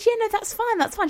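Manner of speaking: high, breezy and clueless; naive self. Retold or imitated. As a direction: squeak it out innocently. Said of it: "I said".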